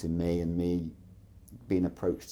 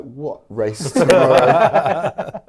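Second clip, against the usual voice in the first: loud, slow, laughing disbelief in a Plymouth voice; incredulous; 7 semitones higher, 15 dB louder, much slower than usual. Retold or imitated. Told about the race tomorrow, loud laughing disbelief.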